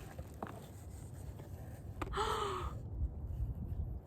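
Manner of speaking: long, breathy gasp of wonder; drawn-out amazed intake of breath; a real gasp at a lovely surprise; gasp of delight. Real, not acted. Finds another deck.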